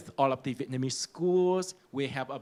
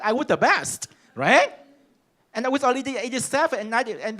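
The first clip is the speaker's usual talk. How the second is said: higher and louder, indignant; indignant outburst; 8 semitones higher, 6 dB louder, faster than usual. Real, not acted. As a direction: insist you were the best, almost shouting. Recalling the grade injustice.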